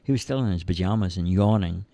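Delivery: plain reading voice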